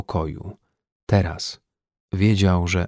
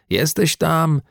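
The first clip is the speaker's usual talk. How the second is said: higher-pitched questioning call; 7 semitones higher, slower than usual. A narrator's reading. Rescuers outside the locked room check on him, calling out. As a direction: call through a door to check on someone. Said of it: "usłyszał wykrzykiwane".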